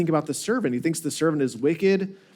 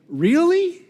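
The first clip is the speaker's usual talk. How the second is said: sharp rising disbelief, much higher; incredulous really; 12 semitones higher, 3 dB louder than usual. Retold or imitated. Master questioning the servant in mock surprise, incredulous. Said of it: "the master is saying".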